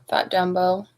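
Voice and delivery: plain reading voice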